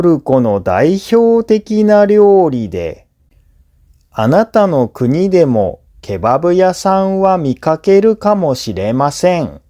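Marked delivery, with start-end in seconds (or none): none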